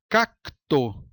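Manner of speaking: "Cacto" broken as "Cac... to"; split into syllables. Shown as a demonstration. Teacher showing an imperfect cluster, "Cacto" split by syllable. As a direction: pause between the C and T of "Cacto". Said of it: "C com o T em sílabas diferentes".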